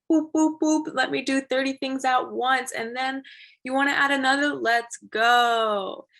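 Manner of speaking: high, loud, bouncy hype; pumped up, building to a loud let's go; eager excitement. Acted. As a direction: act out bubbly, can-do energy. Acting out her eager multitasking energy.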